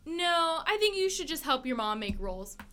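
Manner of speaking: ordinary talk, nothing marked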